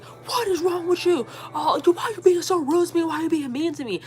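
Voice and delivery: high-pitched mock yelling; retold yelling. She yells at me in the car, high mock yelling. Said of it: "she started yelling at me".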